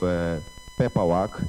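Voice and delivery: ordinary talk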